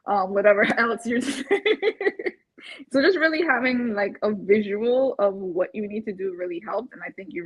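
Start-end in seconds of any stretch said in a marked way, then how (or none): 1.5-2.3 high laugh. Real, not acted. Client laughing about cutting back on Uber Eats. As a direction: laugh at your own habit.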